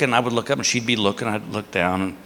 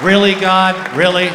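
louder, exasperated appeal to the sky; loud disbelieving protest to God; 5 semitones higher, 5 dB louder, slower than usual. Real, not acted. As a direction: complain up to God, fed up.

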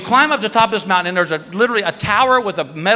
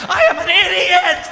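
yelled self-reproach; 11 semitones higher than usual. Retold or imitated. Hurtling through twigs, shouts high at himself. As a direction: shout it at yourself mid-ride. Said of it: "I'm like".

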